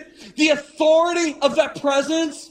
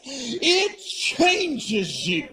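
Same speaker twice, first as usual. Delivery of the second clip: slow solemn emphasis; much slower than usual. Real, not acted. Solemn sermon climax.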